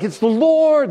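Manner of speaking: loud, high cry of joy; overjoyed yelling. Acted. John spotting Jesus from the boat, overjoyed yell. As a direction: call it out, acting overjoyed. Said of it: "They're just screaming and yelling".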